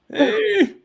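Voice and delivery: put-on high-pitched old Southern farewell; old Southern man. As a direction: crackle like an old Southern grandpa. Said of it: "an old Southern".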